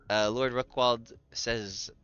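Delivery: ordinary talk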